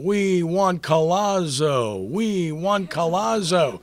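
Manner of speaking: high, loud, drawn-out fan chant; imitated drunken screaming. Drunk fan behind him at the game, screaming out. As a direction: stretch it out slowly like an inebriated fan hollering. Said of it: "he kept screaming out".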